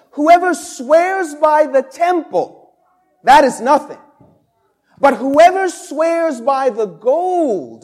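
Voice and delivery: mock the Pharisees' oath with scorn; fired-up preaching. Pastor voicing Jesus mocking the blind guides, fired up. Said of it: "Now Jesus is getting fired up".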